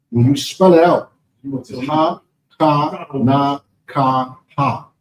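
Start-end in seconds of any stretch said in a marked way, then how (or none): none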